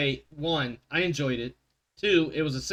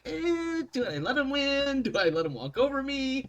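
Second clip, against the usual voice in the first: mock GM voice; 10 semitones higher than usual. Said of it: "the G M being like".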